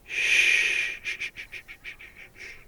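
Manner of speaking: long shh, then short fading shushes; hushing. Acted. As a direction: hush your victim with a string of shhs. Vampire hushes the human.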